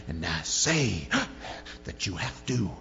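mock televangelist, drawn out and spitting, exploding on the shout; TV preacher exorcism. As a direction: spit out the words like an over-the-top TV preacher. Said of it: "say it with the right inflection and you spit enough".

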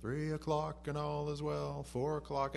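slow, lightly sung-out hour call; a hint of a town crier; night watchman calling. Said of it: "they'd call out what the time was".

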